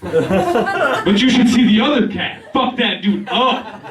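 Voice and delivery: high, slow, laughing tough-cat voice; cat's voice. Retold or imitated. Cat bragging to his owner about a fight, high and slow. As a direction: play the cat bragging through giggles. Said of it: "And he was like".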